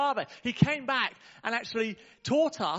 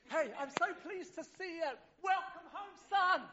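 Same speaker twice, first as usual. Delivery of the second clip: high but hushed, softer welcome home; a gentle embrace in the voice; tender father; 10 semitones higher, 5 dB quieter than usual. Acted. Father quietly welcoming his son home, high and tender.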